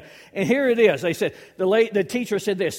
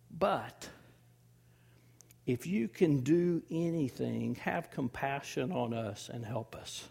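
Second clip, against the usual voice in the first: quiet plea; 6 semitones lower, 9 dB quieter than usual. Retold or imitated. Father's desperate plea, retold quietly.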